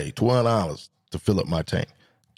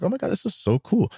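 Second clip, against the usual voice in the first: high, swooping mock lady voice; gushing lady; 9 semitones higher than usual. Lady admiring the scooter's seat compartment, gushing high voice. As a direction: imitate a lady gushing over it. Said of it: "And she was like".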